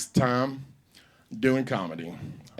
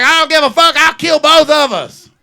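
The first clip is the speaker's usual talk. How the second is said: furious shout; 13 semitones higher, 15 dB louder than usual. Retold or imitated. Mama screaming back at her son, furious and loud. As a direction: yell it like you mean it. Mom threatening in the car.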